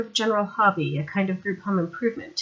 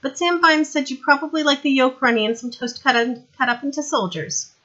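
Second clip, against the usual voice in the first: put-on higher, louder young woman, brisk; young woman's voice; 7 semitones higher, 5 dB louder than usual. Sandra explaining to Vimes how she got there, higher young woman voice. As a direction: play her bright and eager.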